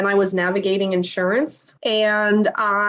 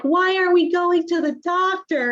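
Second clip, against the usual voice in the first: put-on child's question; 9 semitones higher than usual. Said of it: "My daughter's like".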